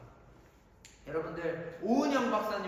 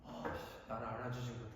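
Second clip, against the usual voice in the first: mock grumble; 10 semitones lower, 14 dB quieter than usual. Pastor voices his own hurt grumble.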